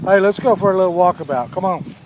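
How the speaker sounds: bright, fast, loud call to the puppies; rally the pups for a walk; upbeat quick invitation; cheery call. Real, not acted.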